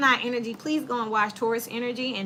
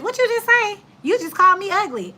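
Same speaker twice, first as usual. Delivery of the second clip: high, loud indignant challenge; mock offended; 9 semitones higher, 7 dB louder than usual. Imitating someone insulted by a backhanded compliment, offended. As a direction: call them out, voice shooting up.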